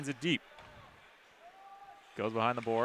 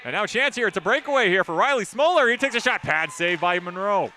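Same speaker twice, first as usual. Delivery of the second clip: rising, louder breakaway call; excited play-by-play; 6 semitones higher, 5 dB louder than usual. Real, not acted. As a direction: build the call up as the shot comes. Breakaway shot in hockey call.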